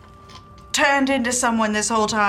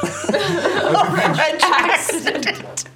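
put-on French accent joke; 9 semitones higher, 3 dB louder, slower than usual. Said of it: "every time I try to do a French accent".

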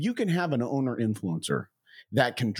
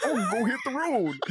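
teasing high Southern accent imitation; 10 semitones higher than usual. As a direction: mimic his Southern twang, mockingly. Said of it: "your Southern accent?".

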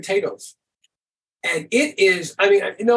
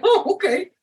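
mock surprised; 13 semitones higher than usual. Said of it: "I'm like".

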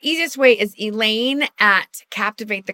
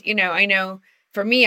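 ordinary delivery

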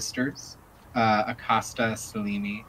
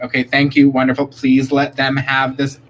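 shooing fans politely; 3 semitones higher, 8 dB louder than usual. Acted. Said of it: "just be like".

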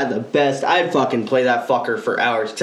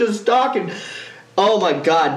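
normal talking voice

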